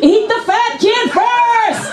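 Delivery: high, drawn-out call; slow, high mock battle order; gleeful command. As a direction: send the horde off with a vengeful cry. Calling orders to zombies.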